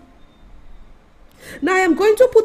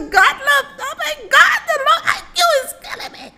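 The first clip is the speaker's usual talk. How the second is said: mock wail; 10 semitones higher than usual. Vlogger wailing to God in mock despair about his country.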